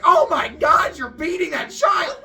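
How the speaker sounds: high, very loud crowd outcry; horrified yelling. Retold or imitated. Storyteller as crowd watching the beating, horrified yelling. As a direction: yell in mock horror at a beating. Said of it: "they're yelling back and forth".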